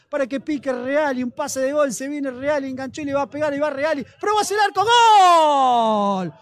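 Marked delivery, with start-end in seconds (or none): 4.2-6.3 long held goal yell, louder; goal scream. Real, not acted. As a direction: let the goal cry ring out.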